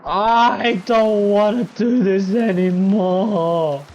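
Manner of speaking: high, loud, slow whine; fed-up whine. Real, not acted. Gamer fed up with the level, slow high whine. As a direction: drag it out, utterly fed up. Frustrated with the game.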